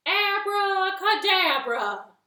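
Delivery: loud, slow, grand abracadabra; magic word. Acted. Frog ends spell.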